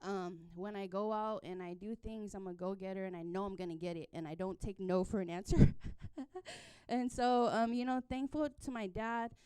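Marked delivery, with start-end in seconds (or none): 5.8-6.8 breathy laugh. Real, not acted. Laughing at her boast.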